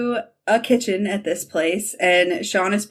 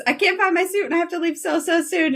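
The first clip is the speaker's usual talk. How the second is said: higher, fast, frantic rush; mock panic; 8 semitones higher, faster than usual. Son panicking to his dad, fast and high. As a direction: blurt it out in a hurry, mildly frantic.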